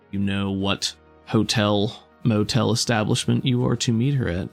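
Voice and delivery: ordinary delivery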